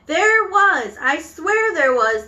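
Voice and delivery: high, loud protest; shouting back. Acted. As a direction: insist indignantly at full voice. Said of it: "The man was shouting back".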